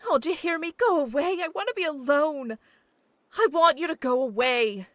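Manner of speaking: loud, sharp demand; insistent outburst. Acted. Jilted woman ordering her friend out, loud. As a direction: order her out, raw with hurt.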